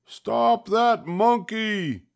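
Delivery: high, slow yell of an angry man. Acted. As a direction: shout after the runaway monkey. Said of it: "the man yelled".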